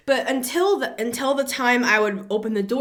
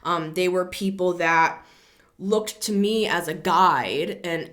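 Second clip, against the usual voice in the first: ordinary delivery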